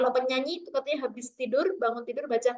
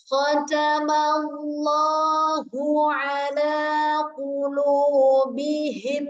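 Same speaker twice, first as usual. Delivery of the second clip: very slow, drawn-out verse, louder; Quran recitation; 3 semitones higher, 4 dB louder, much slower than usual. Shown as a demonstration. Teacher recites the verse for the class. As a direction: recite slowly like tilawah.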